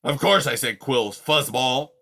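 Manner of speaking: put-on loud grumpy old creature voice; gruff old porcupine. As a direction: grumble like a cranky old porcupine. Aerith speaking.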